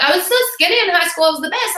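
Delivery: mock a bragging former skinny girl. Imitating girls bragging about high school, high and girly. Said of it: "Some girls are like".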